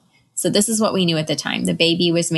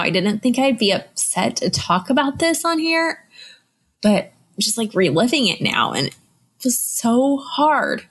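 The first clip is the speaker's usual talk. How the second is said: real tears rising, voice cracking high; fighting back tears as the memory returns; voice breaking; 6 semitones higher than usual. Real, not acted. Mother retelling her pregnancy loss on the podcast, voice breaking. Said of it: "I'm, like, reliving this now".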